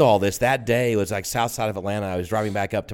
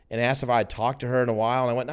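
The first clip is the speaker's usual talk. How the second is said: ordinary talk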